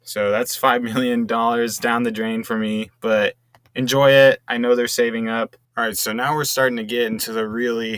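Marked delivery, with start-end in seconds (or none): 3.7-4.4 loud call-out. Real, not acted. Hands over money, calls out.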